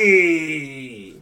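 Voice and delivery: drawn-out shouted announcer name; ring announcer call. Retold or imitated. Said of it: "He holds the whole thing".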